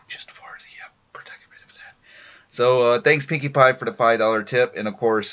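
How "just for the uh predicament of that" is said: hushed whisper so the folks next door can sleep. Real, not acted. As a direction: keep it to a whisper, people are sleeping. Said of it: "I got folks sleeping next door".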